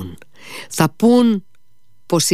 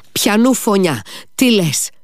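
high, startled questions; shocked demand; 9 semitones higher than usual. A narrator's reading. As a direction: demand an answer in alarm. Stunned Alyosha repeats his question.

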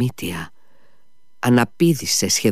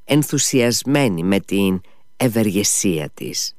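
plain reading voice

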